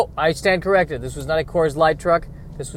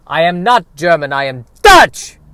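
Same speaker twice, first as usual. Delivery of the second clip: loud protest in bad Dutch accent; shouted Dutch accent; 3 semitones higher, 10 dB louder than usual. Dutchman protesting to a Frenchman, shouted Dutch accent. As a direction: shout it in a fake Dutch accent, exploding at the end. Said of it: "you are just shouting them".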